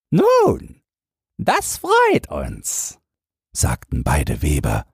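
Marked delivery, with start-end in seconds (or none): 0.1-3.0 very high, slow squeaky trickster voice; gleeful swindlers. Acted. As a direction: cheats savoring their success, squeaking. Weavers' gleeful reply.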